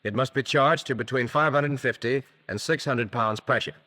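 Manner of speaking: usual speaking voice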